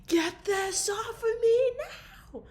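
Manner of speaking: high, slow, crying yell; frustrated yell. Retold or imitated. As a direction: wail it out in frustration. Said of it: "I'm like".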